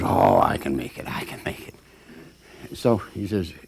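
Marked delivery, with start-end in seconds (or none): 0.0-1.1 a low, strained, lightly voiced push; low strained effort. 1.1-1.8 near-whispered, much softer gasp; breathy exhausted whisper; breathless effort. Acted.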